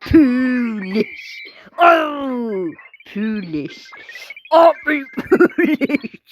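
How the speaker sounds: high loud mock cry of Polish; teasing shout. Kids teasing a friend about speaking Polish, yelling. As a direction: wail the word teasingly.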